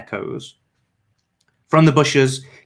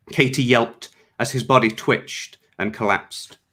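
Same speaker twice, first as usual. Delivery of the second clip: usual speaking voice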